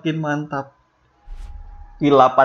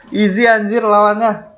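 laughing, pitched up high; 7 semitones higher, 3 dB louder than usual. Real, not acted. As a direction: gloat through laughter. Laughing after easy kills. (tertawa senang)

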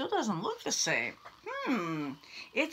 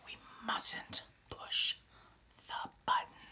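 slow, secret whisper; 12 dB quieter than usual. Acted. Larry warning the reader in a slow whisper. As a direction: hush it like a guilty secret. Sneaking toward the button.

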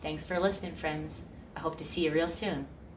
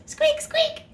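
much higher squeaky mouse noise; mouse squeak; 6 dB louder than usual. Pretend. Squeaking high for kids as a silly wrong answer. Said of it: "A dog says".

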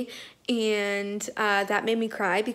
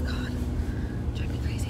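sighing exasperation; 10 semitones lower, 6 dB quieter, faster than usual. Real, not acted.

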